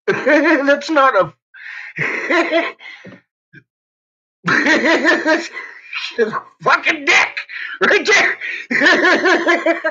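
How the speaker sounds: real laughter through the words; laughing. Real, not acted. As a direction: try to say it while cracking up. Laughing at own story.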